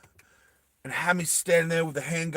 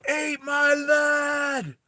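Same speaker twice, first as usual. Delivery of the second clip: high, drawn-out battle scream; 12 semitones higher, much slower than usual. Acted. Vas taking all the abuse out on Bella, screaming. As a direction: scream it slowly, stretching the words. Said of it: "that's why he's screaming out like that".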